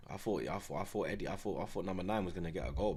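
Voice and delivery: ordinary talk, nothing marked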